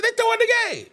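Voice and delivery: high pitched half-joking claim; mock conspiracy. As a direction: sell it like a wild conspiracy. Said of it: "I said".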